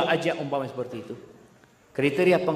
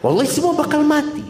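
emphatic outburst; 9 semitones higher, 5 dB louder, faster than usual. Real, not acted. Preacher's emphatic punchline.

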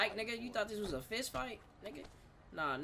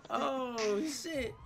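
usual speaking voice

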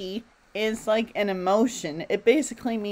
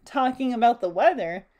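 usual speaking voice

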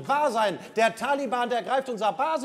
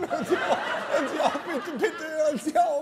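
laughing plea; 7 semitones higher than usual. Acted. Captain pleads laughing.

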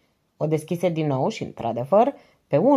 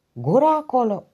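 loud, higher bark of Gura acolo; 7 semitones higher, 5 dB louder than usual. Acted. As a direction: cut the noise in the next room with a sharp shout. Commissioner silences noisy inspectors' office.

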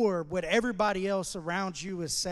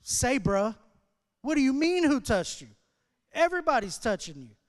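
higher, louder, disbelieving "what do you mean"; incredulous crowd; 5 semitones higher, 6 dB louder than usual. Retold or imitated. Crowd answering Jesus, loud and incredulous. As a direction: play the crowd scoffing at the question. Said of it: "everybody around him's like".

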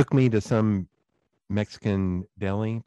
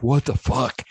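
stunned exclamation; 4 semitones higher, 4 dB louder than usual. Retold or imitated. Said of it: "he was just like".

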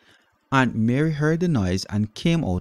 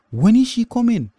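put-on high, loud child voice; eager little boy; 13 semitones higher, 6 dB louder than usual. A narrator's reading. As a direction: ask like an anxious small boy. Little Peter asks.

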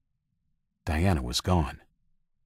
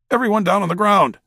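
loud barked order, much higher; ordered shout; 6 dB louder, faster than usual. Acted. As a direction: shout the command at the crowd. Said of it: "someone ordered".

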